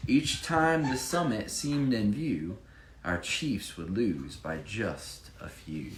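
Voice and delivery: lively rhyming read-aloud; bouncy storybook reading voice; story narration. Acted. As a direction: read it like a picture book for children. Reads rhyming Chiefs picture book.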